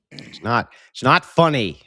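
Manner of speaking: mock anger breaking into laughs; scold, then crack up; anger turning to laughter. Real, not acted. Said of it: "Stop laughing, chat".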